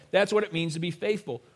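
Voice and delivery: ordinary delivery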